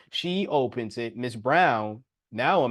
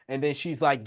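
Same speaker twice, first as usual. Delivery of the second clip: normal talking voice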